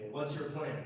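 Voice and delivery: usual speaking voice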